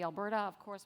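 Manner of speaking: ordinary delivery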